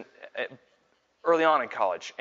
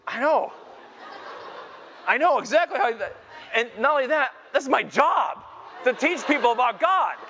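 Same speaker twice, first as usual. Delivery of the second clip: high-pitched, hammed-up indignant rant; worked-up dad venting for laughs; comic exasperation; 8 semitones higher than usual. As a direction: protest in mock despair, voice pushed way up. Mock outrage at own parenting failure.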